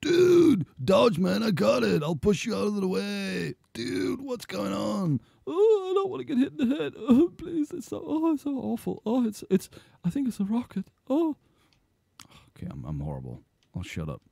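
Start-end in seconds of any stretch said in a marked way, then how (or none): 0.0-3.5 high, strained mock-urgent yell; hammed-up game character to the rescue; heroic buddy shout. Voices a second game character. 3.7-5.2 very high, drawn-out mock confusion; hammed-up slow, clueless dude; bewildered game character. 5.5-11.3 squeaky, very high mock whine; hammed-up pitiful fretting; whimpering game character. Voices game character about to be hit.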